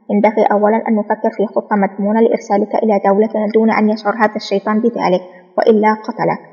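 ordinary delivery, nothing marked

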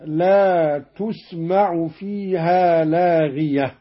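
لاغية recited "laghiyatun" ending in u, with تسمع as "tusmaʕu"; nominative reading. Shown as a demonstration. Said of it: "بالرفع هكذا".

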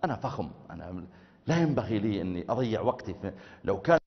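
a touch of vanity muttered; arrogant inner voice. Pretend. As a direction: dismiss the humble invite. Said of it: "فقلت في نفسك".

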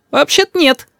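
put-on very high child's reply; little boy. A narrator's reading. Boy concedes to grandfather, childlike.

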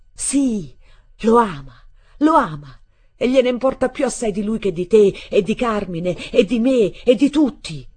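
loud, high, raving tirade; frenzied shouting. A narrator's reading. Boy raving at his stepmother in jealousy, frenzied. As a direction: hammer each item out at full shout, like a madman. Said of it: "io ripresi come un forsennato".

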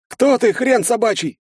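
strangled, wheezing gasp; choked wheeze. Acted. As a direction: croak it while being choked. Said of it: "задыхаясь, прохрипел я".

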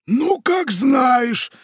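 very high, loud, slow shrug-off; dismissive pirate. Acted. Pirate dismisses the parrot, high and slow.

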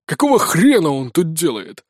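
gruff, annoyed question; mildly put-on rough irritation; displeased bandit chief. A narrator's reading. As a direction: grumble it as a brigand boss. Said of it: "недовольно спросил Грок".

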